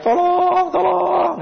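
high-pitched drawn-out mock cry; imitated high call for rescue; cry for help. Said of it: "ada ibu-ibu teriak-teriak".

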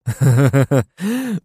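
high manic laugh; maniacal cackle. Acted. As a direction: cackle with sick delight. Hero laughs at discovery.

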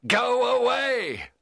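loud, high, drawn-out cry; cried out. Acted. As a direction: hurl it out in revulsion. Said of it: "I cried".

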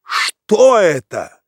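high, loud, slow cry; startled cry. A narrator's reading. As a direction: cry out in alarm. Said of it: "вскричал Иван Ильич".

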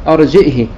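"أرجئه" with a glottal stop and short final i; hamza, short kasra. Shown as a demonstration. Teacher demonstrating Ibn Dhakwan's reading, "أرجئه" ending in short i. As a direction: say "أرجئه" as arji'hi. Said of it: "كسر الهاء".

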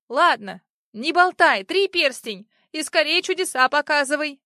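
impatient urging. A narrator's reading. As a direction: hurry him up sharply. Impatient tsar urging Afonya.

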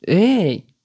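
sudden high loud "hey"; startled shout. Acted. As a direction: jump at it, loud. Arrow lands beside instructor.

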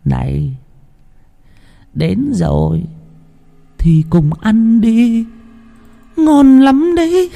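high, slow ghostly croon rising up; eerie possessed voice. Acted. Possessed sister-in-law coaxing Út Phượng to eat, eerie high croon. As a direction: lure her in like a demon, drawn out and high.

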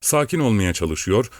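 plain reading voice

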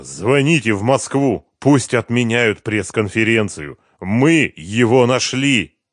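ordinary delivery

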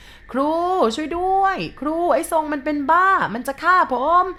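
high, loud panicked screaming to the teacher; frantic high shout for help; terrified cry for help. A narrator's reading. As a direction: yell like he's about to be killed. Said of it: "แกร้องเสียงดังเหมือนจะโดนฆ่าจริงๆ".

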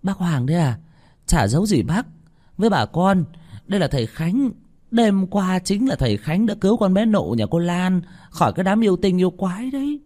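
high-pitched, eager woman's voice; keyed-up answer, pitched well above narration; hurried reply. A narrator's reading. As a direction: explain eagerly to the village chief. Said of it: "Bà Hương vội đáp".

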